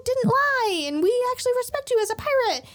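high, loud, eager squeal; little kids' rallying cry. Retold or imitated. Onion, Carrot and Apple pledging to fight, high rallying cry. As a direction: pipe up in a high, excited voice. Three kids rallying to Usopp, acted out.

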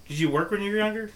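normal talking voice

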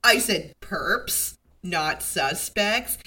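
slow, with "perps" and "suspects" set apart for emphasis; pointed correction. Retold or imitated. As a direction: lean on "perps", then "suspects", spelling out the difference. Detective correcting his boss, acted.

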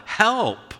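high, urgent call for help; yell for help. Shown as a demonstration. Preacher demonstrating a cry for help in a mall. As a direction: cry out as grandma faints. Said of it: "appropriate to yell".

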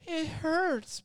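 hammed-up little cry of pain; whiny hurt. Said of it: "she was like".

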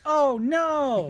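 loud, high oh no; real alarmed groan; surprised dismay. Real, not acted. Reacts to spell slots.

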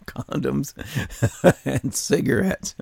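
giggling through the words; can barely get it out for laughing; quiet giggly disbelief. Real, not acted. Cracking up at the article.

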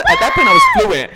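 squealing high laugh. Real, not acted. Laughing at own story.

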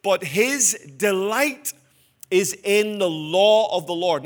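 quoting scripture. Acted. Pastor quotes verse two to congregation, slow and emphatic. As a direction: lean on delight, land law with a fall. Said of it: "Verse two says".

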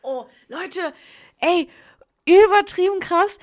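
suddenly loud and high, thrilled; excited. Real, not acted. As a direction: burst out with real excitement. Excited outburst about Instagram story.

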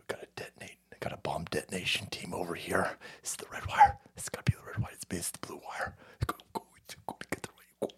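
tense whisper over the wires, like a movie cop defusing a bomb; hushed and urgent, red or blue wire; bomb squad whisper. Pretend. Streamer plays a nineties movie cop defusing a bomb, whispering.

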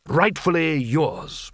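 slow, ceremonious presentation; stately and very measured; with a flourish. A narrator's reading. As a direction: draw it out grandly, as if presenting a treasure. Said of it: "offered it to the boy with a flourish".

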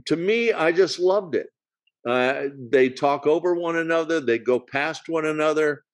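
ordinary talk

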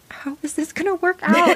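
high, tense wondering; anxious wondering. Retold or imitated. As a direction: voice the nail-biting suspense. Excited retelling of suspense.

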